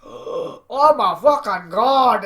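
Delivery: drawn-out loud amazed cry; gasp, then scream it in awe, slowly; wowed boss. Retold or imitated. Vince seeing Jinder, slow loud amazed cry. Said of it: "his eyes go buggy".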